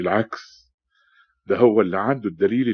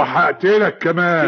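raised, drawn-out menacing shout; angry threat; 7 semitones higher, 6 dB louder, slower than usual. Acted. Cheated man threatening his debtor, angry. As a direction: throw the threat out high and loud. Threat in a quarrel over money.

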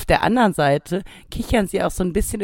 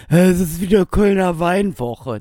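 a touch of a radio host. Pretend. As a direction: announce it like local radio. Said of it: "der Radiomoderator von Radio Köln". (Radiomoderator-Stimme)

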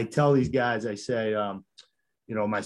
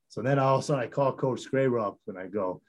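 normal talking voice

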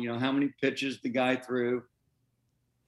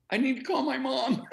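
high, laughing retold thrill; giddy excitement; 11 semitones higher than usual. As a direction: squeak it out, breaking into laughter. Retells big-league news reaction.

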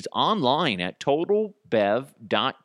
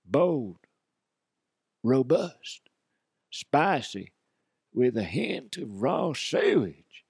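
slow, grand ad voice; commercial announcer; 2 semitones higher than usual. Acted. Host voices a fake perfume commercial, slow announcer voice. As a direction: sell Turds of Desire like a perfume ad.

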